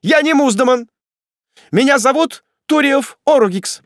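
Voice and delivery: high, loud declaration; desperate shout. A narrator's reading. Prisoner revealing his real name, desperate shout. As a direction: cry out your true name. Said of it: "вдруг вскричал он".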